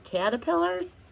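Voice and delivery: squeaked high in horror; horrified. A narrator's reading. Homily horrified at eating caterpillars, high shriek. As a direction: recoil at the thought.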